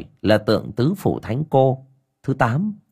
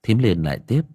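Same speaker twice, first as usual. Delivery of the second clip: ordinary delivery, nothing marked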